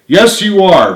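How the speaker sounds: protesting. Acted. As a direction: insist firmly. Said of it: "protested Richard".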